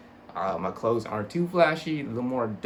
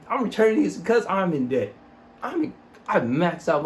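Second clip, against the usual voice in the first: exasperated outburst; 6 semitones higher, 5 dB louder than usual. Real, not acted. Mock confession of debt.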